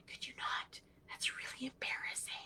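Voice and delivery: hushed, voiceless mock plea; mortified whisper. Girls behind their mom begging her to stop, imitated mortified whisper. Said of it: "they look mortified".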